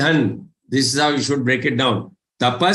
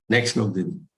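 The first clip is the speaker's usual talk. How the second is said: quiet aside; 5 semitones lower, 5 dB quieter, much faster than usual. Real, not acted. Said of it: "aside to assistant".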